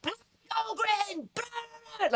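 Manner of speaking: high, strained Warhammer war bark; barking battle shout. Retold or imitated. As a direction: bark it out like a furious warlord on the battlefield. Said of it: "three-hour barking sessions".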